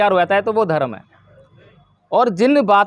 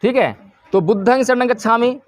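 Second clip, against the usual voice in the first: usual speaking voice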